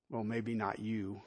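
low, soft, slow teasing aside; dry aside. Real, not acted. Preacher teasing the congregation, low and soft. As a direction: murmur it wryly.

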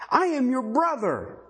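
mild imitated cry, pitched high. Preacher voicing the man accused by his brothers, mildly crying out. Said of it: "He cried".

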